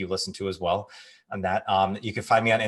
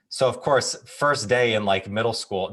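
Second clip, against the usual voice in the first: ordinary talk, nothing marked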